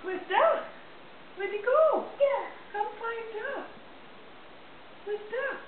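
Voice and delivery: high, bright singsong question. Real, not acted. Parent playing with toddler.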